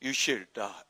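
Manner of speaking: deep, unaccustomed actor's delivery; put-on low voice. Shown as a demonstration. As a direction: say it pitched well down. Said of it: "안 하던 거 나오니까 이상하다". (낮게 깐 배우 목소리로)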